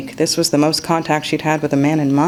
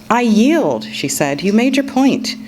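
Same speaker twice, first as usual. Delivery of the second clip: high, bright, conceding with a laugh in it; playful surrender; 6 semitones higher than usual. A narrator's reading. Sasha yielding to Luke in their verse game, high and playful. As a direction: throw up your hands and give in gracefully.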